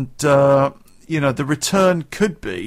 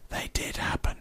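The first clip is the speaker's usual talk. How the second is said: whispered correction, much softer; quick whisper aside; 11 dB quieter than usual. Real, not acted. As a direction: slip it in under your breath. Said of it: "I got that one the wrong way around".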